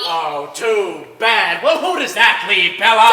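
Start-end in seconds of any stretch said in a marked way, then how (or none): 1.6-3.1 louder, pressing question; yelling. Acted. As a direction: yell the question at her. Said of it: "Don't yell at me".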